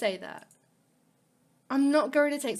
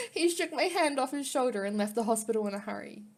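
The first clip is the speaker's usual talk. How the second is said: ordinary delivery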